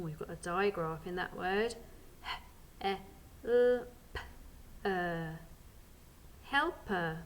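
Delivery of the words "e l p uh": E, L, P, uh as separate phonics sounds; sounding out. Shown as a demonstration. Teacher repeats the sounds before blending, sounding out. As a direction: say E, L, P, uh sound by sound, not as letter names. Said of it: "Ready to sound out?".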